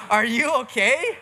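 high, loud, slow worried question; concerned. Retold or imitated. Asking the crying teacher if she is okay, high and slow with concern. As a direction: ask gently but urgently if she's alright. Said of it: "You know".

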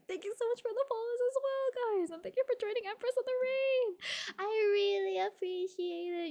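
high, sing-out thank-you voice; gushing thanks. Real, not acted. Streamer thanks followers and raiders, high and gushing. Thanking viewers after a raid.

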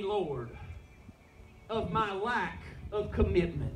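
slow, earnest prayer in short phrases; leading a prayer. Real, not acted. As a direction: pray phrase by phrase, leaving room to repeat. Leads a repeat-after-me prayer.

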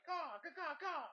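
high, loud squawking like a hungry chick; baby bird caw. Pretend. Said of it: "like I'm a baby bird".

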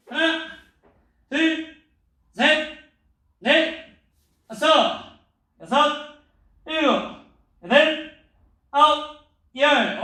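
loud, high drill count; shouted count. Acted. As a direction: bark out the count for the class.

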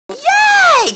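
high, loud delighted cry; excited cheer. Real, not acted. Owner cheers parrot finding its nut, delighted yell. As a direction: cheer like she just won.

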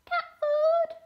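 loud, very high yowl; piercing high kitty cry; meowing cat. A narrator's reading. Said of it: "meowed Mildred".

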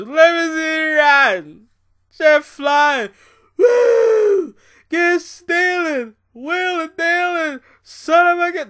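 high, loud hammed-up Ric Flair voice with a wild woo; Ric Flair impression. Wrestling fan mocking Ric Flair's promo, loud hammed-up impression. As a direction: yell it like a strutting wrestling legend. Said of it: "All I'm hearing about is".

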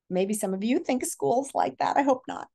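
ordinary delivery

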